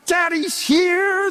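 high, stretched-out homecoming call; excited dad's shout. Retold or imitated. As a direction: call out like a thrilled returning father. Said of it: "and I would shout".

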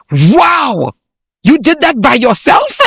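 lifted, overdone amazement; mock amazed praise. Telling the hosts about patronising people, mock amazed praise. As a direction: gush like an impressed stranger. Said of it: "people would be like".

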